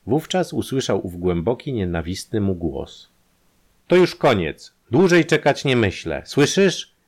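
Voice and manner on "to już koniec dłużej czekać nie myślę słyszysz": loud, contemptuous threat; threatening. Acted. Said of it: "W głosie tym było lekceważenie i groźba".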